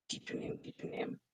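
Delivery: barely audible, whispered thinking aloud; very soft, absent-minded murmur; muttering to oneself. Real, not acted. Thinking aloud, searching for a name.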